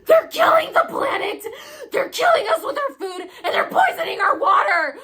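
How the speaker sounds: high, loud, tearful shouting; crying out a furious warning to everyone; yelling to everyone. Retold or imitated. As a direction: yell the warning to the whole room. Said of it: "I yelled to everyone".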